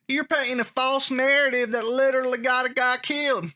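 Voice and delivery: put-on Southern accent for an online commenter. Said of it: "let me try the Southern accent".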